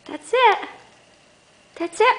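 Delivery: high praise voice. Real, not acted. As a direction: cheer the dog on.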